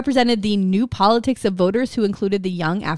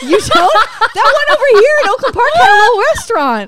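laughing; 13 semitones higher, 9 dB louder than usual. Real, not acted. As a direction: giggle through it, voice way up. Gushing memory of Kmart diner.

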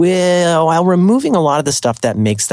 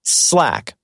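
ordinary delivery

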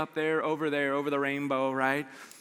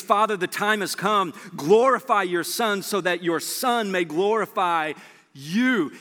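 solemn prayer; 3 semitones higher, slower than usual. Acted. Jesus praying, read solemnly.

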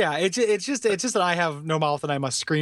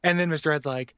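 normal talking voice

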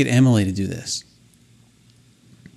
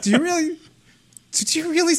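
imitating Emily; 12 semitones higher than usual. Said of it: "if I can't do the accent".

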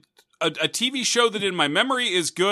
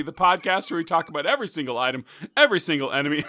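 ordinary delivery

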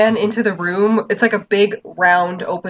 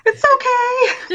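high drawn-out mock; much slower than usual. Repeating the friend's forgiveness.

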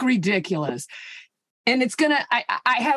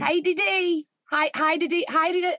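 repeated cheery mock Aussie hellos, pitched up; Australian accent; 7 semitones higher than usual. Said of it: "that's what they say".

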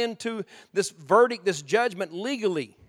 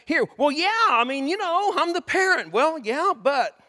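defensive bickering; 9 semitones higher, 6 dB louder than usual. Retold or imitated. Quarrel over being the parent.

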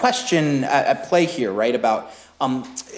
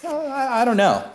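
much higher, unsure fumbling answer; hesitant high reply; 10 semitones higher than usual. Retold or imitated. New father lost for words, high and hesitant. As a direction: stammer it out unsure. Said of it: "It's like".

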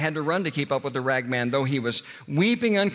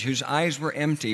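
usual speaking voice

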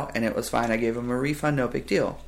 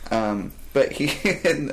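usual speaking voice

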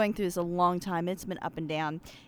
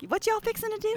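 Southern accent; 12 semitones higher than usual. Shown as a demonstration. To the hosts, showing off a put-on Southern accent. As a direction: ham up a Southern twang. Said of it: "from my accent".

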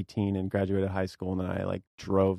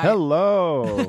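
high, loud showman hello; announcer hello; 10 semitones higher, 9 dB louder than usual. Acted. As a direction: greet the audience like a host. Mock podcast intro greeting.

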